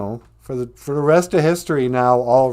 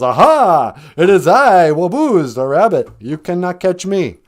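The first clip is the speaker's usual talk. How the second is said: loud gloating reveal, pitched up; triumphant taunt; 6 semitones higher, 8 dB louder than usual. Acted. Rabbit unmasking himself to the fox, shouting in triumph. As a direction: crow it out like a trickster who just won.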